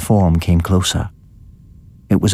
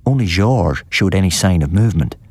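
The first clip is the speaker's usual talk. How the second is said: ordinary delivery, nothing marked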